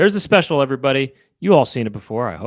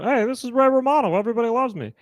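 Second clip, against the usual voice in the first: much higher Romano voice; Ray Romano impression; 10 semitones higher, 3 dB louder, faster than usual. Showing friends how Ray Romano sounds, much higher. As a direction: do Ray Romano, nasal-free and high.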